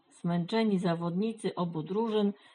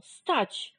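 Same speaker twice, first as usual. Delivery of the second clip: higher-pitched command to halt; trying to stop them; 6 semitones higher than usual. A narrator's reading. Reksio tries to stop the hens attacking, calling out. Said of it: "próbował powstrzymać je Reksio".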